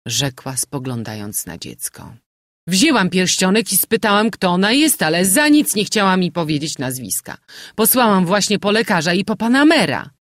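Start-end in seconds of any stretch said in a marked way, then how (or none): 2.7-10.1 higher, louder breathless gossip; agitated landlady. A narrator's reading. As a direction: rush through the sad news. Landlady continuing report.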